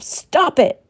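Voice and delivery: high, sharp yell. Acted. Shelley slapping her own face, yelling at herself. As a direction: yell it at yourself. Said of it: "yelling at herself".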